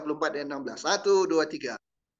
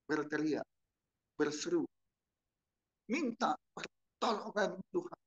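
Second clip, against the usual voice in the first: usual speaking voice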